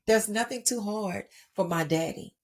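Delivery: ordinary talk, nothing marked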